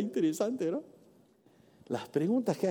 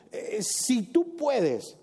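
hesitant doubt; 5 semitones higher, much slower than usual. Acted.

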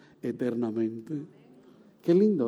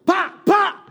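gunshot imitation; 13 semitones higher, 6 dB louder than usual. Gunshots heard on the street.

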